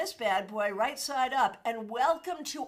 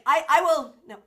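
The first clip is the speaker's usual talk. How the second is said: ordinary talk, nothing marked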